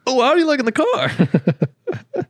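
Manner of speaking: very high, slow, laughing sales pitch; mocking salesman voice. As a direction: ham up the suck-up salesman. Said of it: "his inflection".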